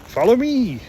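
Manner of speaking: a cartoon animal calling sweetly to Josh; Disney cartoon animal. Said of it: "like a Disney movie and start going".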